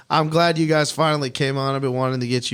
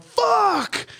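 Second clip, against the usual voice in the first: much higher, cracking cry of pain; pained yell. Retold or imitated. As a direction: yell it as the knee snaps back. Knee popped back, pain cry.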